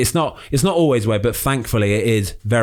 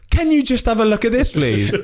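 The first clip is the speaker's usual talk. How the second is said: much higher, laughing wheedling ask; mock cheeky request; 11 semitones higher than usual. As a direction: beg a free check-up through a laugh, pitched way up. Mimics people asking doctor favours.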